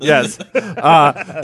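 ordinary talk

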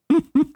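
squeaky burst of laughter; embarrassed giggle; high laugh. Real, not acted. Laugh after forgetting.

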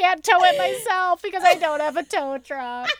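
high, loud, over-the-top gratitude; mock gushing thanks. Woman pretending to thank the tow driver, high and gushing. Mock grateful self to tower.

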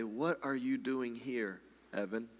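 very soft, low and slow, pausing before "Evan"; quiet, searching, "Evan" almost under the breath; hushed personal question. Shown as a demonstration. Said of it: "read it one last time and say".

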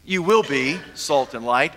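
"will" stressed, left hanging on a rise. Shown as a demonstration. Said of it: "he doesn't say".

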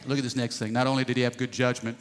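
ordinary talk

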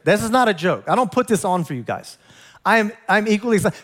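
ordinary talk, nothing marked

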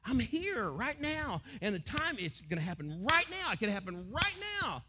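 Jesus calling people to accept him; urgent appeal. Acted. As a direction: plead with them to accept him now. Preacher voices Jesus' appeal.

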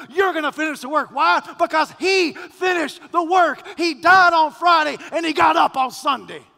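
loud, rising gospel preaching; full-force preaching. Acted. Pastor preaching the resurrection to his congregation, loud and building. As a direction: preach it out to the whole church. Hymn words preached loudly.

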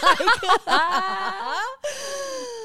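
high, helpless crying laugh; laughing to tears. Real, not acted. Host cracking up at her cohost. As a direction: let the laugh break into tears. Laughing so hard she cries.

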